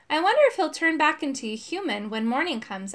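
plain reading voice

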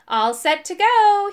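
high, loud and drawn out; happy. A narrator's reading. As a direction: cheerfully announce you're ready. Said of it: "he said happily".

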